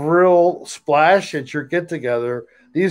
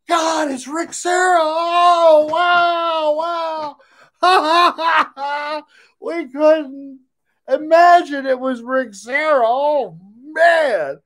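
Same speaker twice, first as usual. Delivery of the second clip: much higher, astonished gasps; surprised unmasking; 12 semitones higher, slower than usual. Pretend. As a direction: act shocked at the reveal. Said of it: "I'm the Masked Singer".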